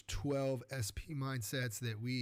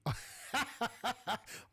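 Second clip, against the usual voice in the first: high cackling laugh at himself; 10 semitones higher than usual. Real, not acted. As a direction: burst out laughing at your own failed attempt. Laughs at his horrible accent attempt.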